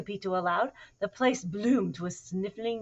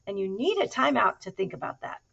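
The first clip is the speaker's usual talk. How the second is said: loud, higher-pitched mama's scolding; 6 semitones higher, 12 dB louder than usual. A narrator's reading. Mama cat sending her kitten to a timeout, scolding. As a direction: scold a naughty child with punched words. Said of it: "she scolded".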